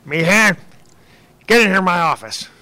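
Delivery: deliberately bad impression of a boss shouting into his office; Scott Kramer impersonation. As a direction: call him in like a gruff boss, fast and loud. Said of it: "I'm gonna do a terrible Scott Kramer impersonation".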